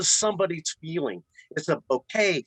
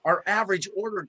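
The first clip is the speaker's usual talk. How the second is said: ordinary delivery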